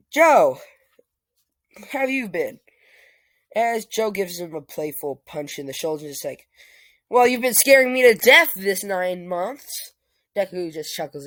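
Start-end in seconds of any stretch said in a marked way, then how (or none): 0.1-2.6 higher and much louder calling his name; glad greeting. Acted. Deku greeting Joe after waking, glad and loud. 7.1-9.9 higher, much louder teasing; playful scolding. Acted. Joe teasing Deku with a punch, loud and playful.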